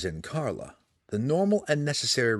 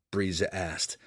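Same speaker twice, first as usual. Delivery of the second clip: usual speaking voice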